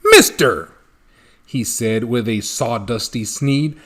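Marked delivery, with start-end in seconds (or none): 0.1-0.7 loud, clipped call; loud bossy "Mister"; sharp, bossy Lorax. Acted. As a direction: summon him bossily. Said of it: "voice that was sharpish and bossy".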